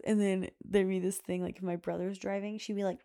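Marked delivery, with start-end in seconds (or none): none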